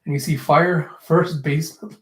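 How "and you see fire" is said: ordinary talk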